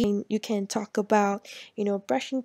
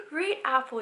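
excited intonation; 12 semitones higher than usual. Shown as a demonstration. Parent showing an excited voice for a child. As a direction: gasp then a lively red apple. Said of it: "imitate my intonation like".

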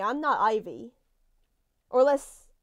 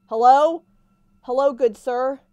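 loud call into the dark; 2 semitones higher, 10 dB louder than usual. Real, not acted. Player calling out to the security guard, loud. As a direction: call out to the stranger.

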